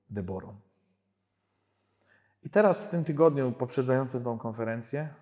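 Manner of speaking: ordinary delivery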